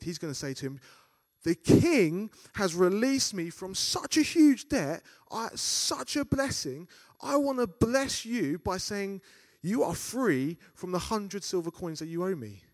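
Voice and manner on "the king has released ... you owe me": much higher, louder, slow and warm-hearted; generous servant. Retold or imitated. Preacher voicing the forgiven servant, higher and louder. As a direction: play the freed servant offering mercy.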